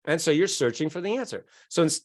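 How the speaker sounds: ordinary delivery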